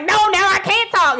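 high, huffy mock protest; mad. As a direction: refuse in acted indignation. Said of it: "So you're mad that I'm calm?".

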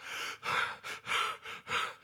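long breathy sigh of relief; long relieved sigh. Real, not acted. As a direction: let out a long quiet sigh. Relief after money arrives.

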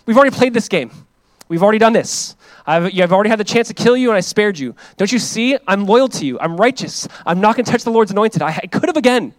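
a touch of worn-out frustration; David telling Saul they have done this already; fed-up David. Retold or imitated. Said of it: "David's like".

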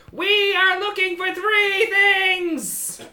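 high, loud, slow proclamation; kobold boss. Acted. Winning kobold addresses visitors, high drawn-out shout. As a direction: announce it in a shrieky little kobold voice. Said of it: "shoves that, uh, designer back ... and says".

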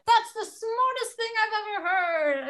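high, loud mocking gush; sarcastic high praise of a coworker. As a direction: parody the men fawning over the idea.

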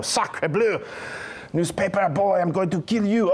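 hammed-up French accent; angry Frenchman, French accent. Pretending to be French.

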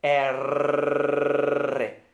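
long rolled Spanish "R"; rolled R demo. Shown as a demonstration. Teacher showing students the trilled "R", held long. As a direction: trill "R" with the tongue vibrating behind the teeth. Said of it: "algo bien importante".

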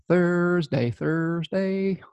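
put-on high, playful mystery accent; made-up Thursday accent. Astrologer playing with the day's name, silly made-up accent. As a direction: sing out an unplaceable accent, pitched high. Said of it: "I don't know what, what accent that is".

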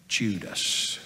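low, quiet drop on the name; grave hushed reveal. A narrator's reading. Peter revealing who led the mob, grave hushed reveal. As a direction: let the name fall softly and low. Names the betrayer, first take.

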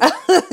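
short, high, loud laugh; quick burst of laughter. Real, not acted. Host amused by her own switch, laughing.

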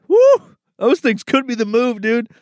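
high loud whoop of delight; excited whoop. Real, not acted. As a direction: let out a sudden happy woo. Excited over pickles.

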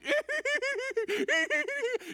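high, loud imitated cackle; exaggerated high-pitched giggling; mocking group laughter. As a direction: laugh like jeering teammates. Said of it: "And they're all laughing".

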